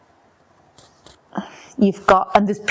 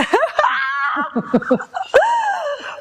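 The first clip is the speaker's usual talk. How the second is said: long high burst of laughter; shrieking laugh. Real, not acted. Joking about loving Irish accents, shrieking laugh.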